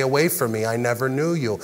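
low, soft dismissal; quiet rejection. Acted. God turning them away on Judgment Day, quiet and low. God's rejection voiced, second telling, subdued.

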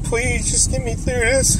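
high, quiet pleading through tears; tearful plea. Real, not acted. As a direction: beg softly while crying. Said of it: "I don't mean to be crying".

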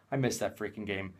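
usual speaking voice

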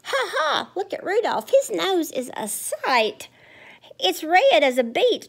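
high taunting sing-song jeer; mocking reindeer. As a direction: tease him like a schoolyard bully.

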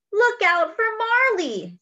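high, loud alarmed call; father yelling about the runaway dog; yelled warning. Acted. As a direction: warn everyone the dog is coming. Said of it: "yelled Daddy".

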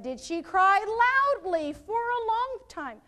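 "loudly" pitched high and stretched out; pointed question with "loudly" punched high; stress on loudly. Shown as a demonstration. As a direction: lean hard on "loudly", then slow down. Stresses the key attributes of wail.

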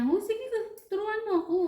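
high, loud, keen acceptance; eager retold reply. Nurse telling a colleague to teach her, eager. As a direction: lift the voice high, re-enacting an eager yes.

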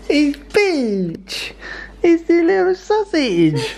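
high squeaky doting dog voice, laughing; overwhelmed by cuteness. Real, not acted. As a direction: gush over the dog, pitch way up. Sees her beloved dog again.

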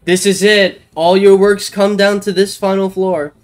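ordinary talk

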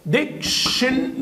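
ديكشن drawn out slowly, dik-shn; slow diction demo. Shown as a demonstration. Teacher spells out the sound for students, slowly. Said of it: "بتتنطق ك".

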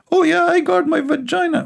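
put-on sing-song falsetto girl boasting to her friend; girly falsetto. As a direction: squeak it out like a silly girl answering. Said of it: "like two girls, they are talking".

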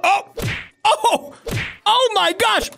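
high, startled oh my gosh; shocked gasp. Real, not acted. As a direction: caught off guard by the hit. Reacting to Granny's attack.

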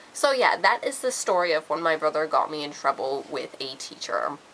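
usual speaking voice

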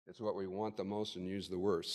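ordinary talk, nothing marked

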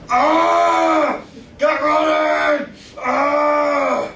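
high, loud bellow at the recruits; drill sergeant yelling. Retold or imitated. Drill sergeant on steroids yells at recruits, loud high bellow. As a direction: roar orders like a steroid-fuelled drill sergeant. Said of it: "he was yelling at those guys all the time".

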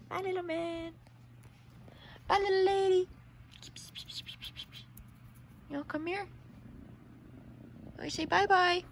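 soft, high cooing to kittens; pet talk. Real, not acted. Farewell to the kittens.

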